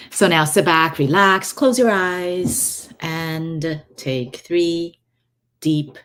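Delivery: slow, close meditation guide voice; right in your ear. Acted. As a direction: ease them into breathing slowly. Starts the guided breathing.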